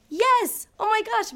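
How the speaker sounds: high, loud burst of joy; a high, loud cry of relief and delight; thrilled yes. Retold or imitated. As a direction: shout it like you just won. Named first place at state.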